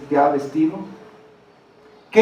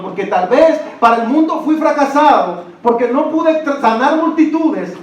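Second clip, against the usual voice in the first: higher, louder fervent preaching; preaching at full force; 5 semitones higher, 5 dB louder than usual. Acted. Pastor preaching to the congregation, high and loud. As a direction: proclaim it from the pulpit.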